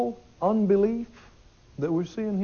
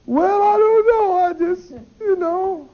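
high, loud, wavering imitation of a crying believer; imitating a doubter crying to the preacher; tearful; 11 semitones higher, 4 dB louder, slower than usual. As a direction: mimic someone near tears, pleading. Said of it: "look at me with tears and go".

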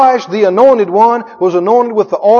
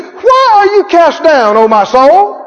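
psalmist's lament; 6 semitones higher than usual. Retold or imitated. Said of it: "He said".

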